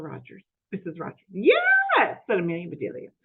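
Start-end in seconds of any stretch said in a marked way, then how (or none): 1.3-3.1 high, bright-eyed maid voice, lightly raised; eager, innocent housekeeper talking to herself; Amelia Bedelia voice. Acted.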